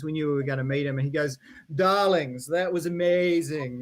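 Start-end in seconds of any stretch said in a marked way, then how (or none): 1.8-3.8 put-on English accent. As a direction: gush it in a drawn-out English accent. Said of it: "and his English accent".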